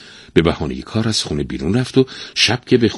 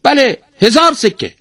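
high, loud parrot voice, falling firmly; emphatic high-pitched bird reply; parrot confirming; 9 dB louder than usual. A narrator's reading. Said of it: "توتی گفت".

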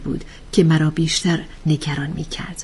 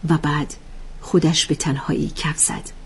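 ordinary delivery

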